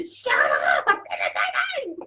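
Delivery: hammed-up shout breaking into laughter; laughing, high-pitched mock outburst; mock yelling. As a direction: yell it as a joke at noisy kids. Mimes yelling at noisy kids mid-meditation.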